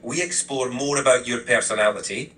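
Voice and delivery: put-on friendly assistant taking on a Scottish accent; Lowland Scottish accent. Assistant obliging a request to reflect his heritage, Lowland Scottish accent. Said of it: "I'll keep the Lowland Scottish accent going".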